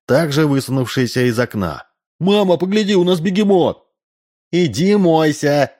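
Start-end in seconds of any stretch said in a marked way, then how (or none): 2.2-3.9 put-on very high child's voice; high-pitched, excited kid announcing a marvel; excited boy calling. A narrator's reading. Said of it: "закричал сын Удалова, Максимка". 4.5-5.7 mother ordering. A narrator's reading. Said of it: "послышался изнутри дома голос Ксении".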